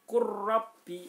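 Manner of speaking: "qurrabbi" recited high and loud with a long rolled r; merged lam into ro. Shown as a demonstration. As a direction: chant "qurrabbi" with no l and a doubled trilled r. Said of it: "lamnya dihilangkan".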